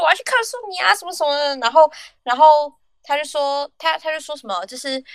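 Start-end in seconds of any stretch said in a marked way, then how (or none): 0.0-1.6 retold angry customer. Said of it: "客人就气冲冲说".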